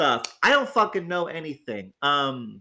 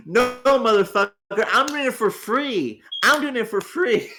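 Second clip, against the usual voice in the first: indignant; 6 semitones higher than usual. Real, not acted. Indignant retort to Zig.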